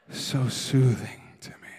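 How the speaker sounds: slow low lovesick whisper; giggly late night call. Retold or imitated. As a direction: sigh it out like a lovestruck teen. Mocking lovers' phone talk.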